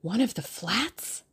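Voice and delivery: slow, awed, a hushed eager ask; breathless question. A narrator's reading. Round-eyed boy asking Tuppence, breathless and eager. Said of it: "demanded breathlessly".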